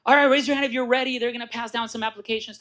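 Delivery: rally the crowd to sign up; imitating a pumped-up meeting host; rallying presenter.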